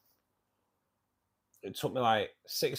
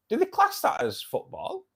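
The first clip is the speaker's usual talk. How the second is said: teasing jab. Real, not acted. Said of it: "only joking, Mister G".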